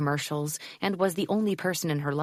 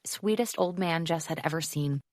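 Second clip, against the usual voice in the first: usual speaking voice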